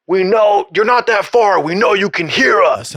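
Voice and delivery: high, loud, called-up instructor; calling up to him. Retold or imitated. Instructors to the man on the tower, high loud call. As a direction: shout it up the tower, sure he's faking. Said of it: "I'm like".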